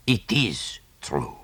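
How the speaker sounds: slow, hushed confirmation. Acted. Poirot quietly confirms.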